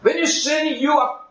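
high, loud climactic preaching; building sermon climax; fiery preaching. Real, not acted. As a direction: drive it home from the pulpit. Preaching at full force.

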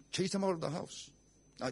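ordinary delivery

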